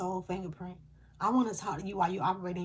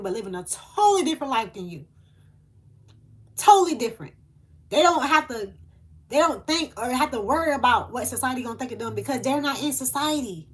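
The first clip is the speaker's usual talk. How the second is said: louder and higher, preaching it out; passionate outburst; 4 semitones higher, 9 dB louder than usual. Real, not acted. Medium preaching to her viewers, impassioned loud delivery. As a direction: build to an impassioned, fervent peak.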